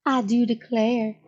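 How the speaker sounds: slow, hammed-up Southern belle; I do declare like a mock belle; slow mock Southern belle line; fake Southern accent. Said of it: "my fake Southern accent".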